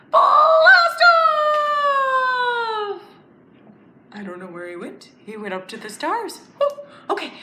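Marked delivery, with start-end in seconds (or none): 0.1-3.0 high, loud blast-off cry; excited shout. Acted. Teacher shouts blast off at the end of the countdown. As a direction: launch it with everything you have.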